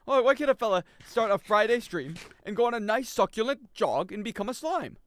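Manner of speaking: high, loud put-on complaining voice, slowing at the end; hammed-up lament about jogging; mock whiny fella. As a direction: whine it like a hammed-up sad fella.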